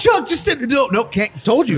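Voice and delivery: mock Chuck excuses. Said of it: "I'm like".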